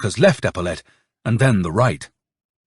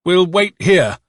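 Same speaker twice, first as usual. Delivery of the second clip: higher, not quite assured order; trying to sound assured; 7 semitones higher, 4 dB louder, slower than usual. A narrator's reading. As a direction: put on confidence that doesn't fully succeed. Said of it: "trying to make his voice sound as assured as possible".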